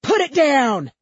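high, loud yell; screamed order. A narrator's reading. Second cop with Glock, screamed order. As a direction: scream it as a police command. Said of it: "two-handing his Glock and screaming".

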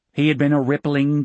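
usual speaking voice

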